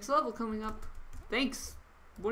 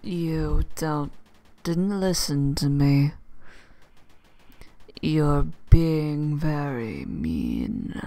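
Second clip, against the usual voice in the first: bad guy voice; 7 dB louder than usual. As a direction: play the looming game villain. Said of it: "That's my bad guy voice".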